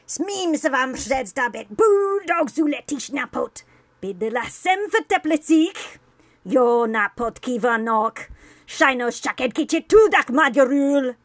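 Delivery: slow, high, very loud demonic yell; creepy yelling. Retold or imitated. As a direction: yell sweet words like a curse. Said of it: "yell nice things in Czech and Hungarian".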